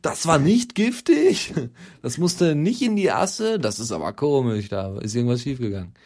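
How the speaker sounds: mock indignant protest. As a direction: huff as if insulted, hammed up. Mock defends her cake, voiced. (gespielt empörter Protest)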